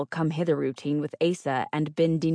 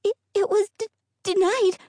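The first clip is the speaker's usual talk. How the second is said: frightened stammer; 5 dB louder than usual. A narrator's reading. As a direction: stumble over words in fear. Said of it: "Her stammers grew worse".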